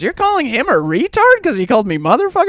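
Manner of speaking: high-pitched, disbelieving question; incredulous mom. Retold or imitated. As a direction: imitate a mom challenging her husband. Mom challenges dad in story.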